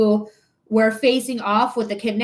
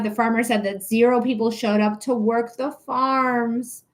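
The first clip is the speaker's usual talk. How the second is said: normal talking voice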